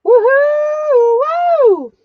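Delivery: very high, loud whoop; excited woohoo. Real, not acted. Host celebrating a buyer's purchase, loud high whoop. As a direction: whoop like your team just scored.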